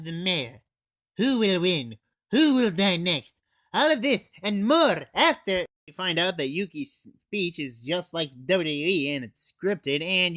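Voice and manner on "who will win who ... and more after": louder dramatic teaser questions; trailer voiceover. Pretend. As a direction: tease it like a movie trailer announcer. Mock trailer teaser for next episode.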